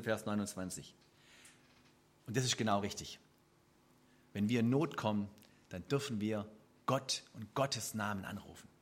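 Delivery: ordinary talk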